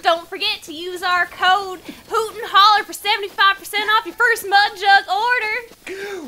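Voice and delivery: loud excited promo read. Acted. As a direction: sell the discount code.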